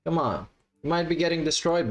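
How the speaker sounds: usual speaking voice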